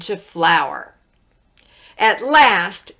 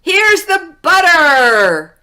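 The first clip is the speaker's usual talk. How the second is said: high, slow excited shout; 8 semitones higher, 6 dB louder, slower than usual. A narrator's reading. Jack bringing the butter to his mother, shouting. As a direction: stretch it out loud and proud. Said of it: "shouted Jack".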